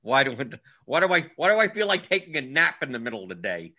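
ordinary talk, nothing marked

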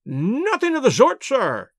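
put-on high, shrill-pitched old lord's outburst; screaming old man. A narrator's reading. Miserly old lord refusing to pay, high screaming. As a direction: fling it out at the top of the voice. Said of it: "screamed the little man".

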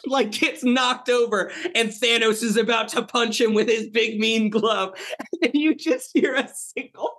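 high-pitched, tickled storytelling; giddy laughing. Real, not acted. Host describing an imagined rescue to co-hosts, giddy and high. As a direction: tell it on the edge of laughter.

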